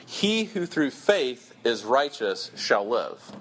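slow and weighty, word by word; awkward Greek order, read slowly; slow, deliberate reading. Shown as a demonstration. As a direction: read each word slowly, like a careful translation. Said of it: "it comes across like this".

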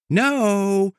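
lilting no up high; a no said like praise; sweet high no. Shown as a demonstration. Showing nobody says no sweetly, lilting high. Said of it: "Nobody says no".

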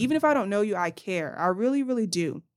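usual speaking voice

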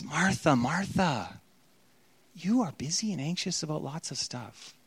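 gentle rebuke. Shown as a demonstration. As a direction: say "Martha, Martha" as a loving gentle correction. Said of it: "It's a gentle rebuke".

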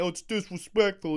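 a sneering mock whine; lightly voiced mock of a sulking player; mocking whiny players. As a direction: mimic a thin-skinned player whining. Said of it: "they can't fucking hear".

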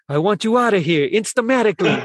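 lightly mimicking the brother shooing her out; Paulie impression. Host imitates Paulie ordering Adrian out, half-hearted tough voice. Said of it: "I can't do the accent, but I'm gonna try to do my best".

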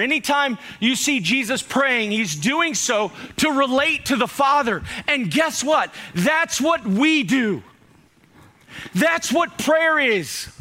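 shouting preacher slowing down; preaching at full force. Real, not acted. Preacher building to climax.

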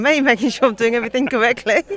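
laughing. Real, not acted. Teasing about uncle checking up.

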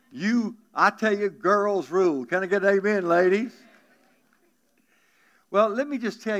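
loud call to the ladies for an amen; preacher's rousing shout for an amen. Real, not acted. Preacher calling out to congregation.

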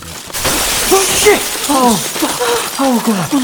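loud, high cry of alarm; panicked outburst. Acted. Man caught by sudden danger high in a building, panicked cries. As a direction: cry out in sudden terror, gasping.

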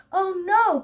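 dismayed cry. A narrator's reading. Zebra learns of the mud, dismayed cry. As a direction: cry out as if caught muddy. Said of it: "cried Zebra".

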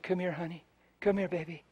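soft, gentle call; tender coaxing. Retold or imitated. Preacher softly coaxing the crying girl to him. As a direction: coax the child softly.

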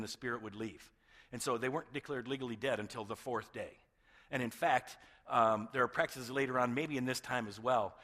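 ordinary talk

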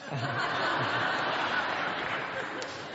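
breathy laugh at own joke; own laughter. Real, not acted. Pastor laughing at his own tithing joke. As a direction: laugh at the punchline.

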